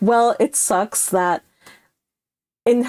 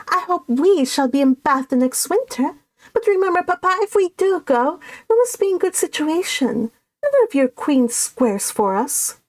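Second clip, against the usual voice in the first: put-on higher, girlish pleading to Papa; eager young ladies; 8 semitones higher than usual. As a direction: coax Papa sweetly in a lifted young voice.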